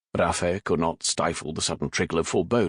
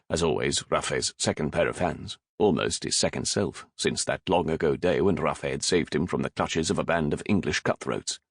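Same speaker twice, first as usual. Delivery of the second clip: ordinary delivery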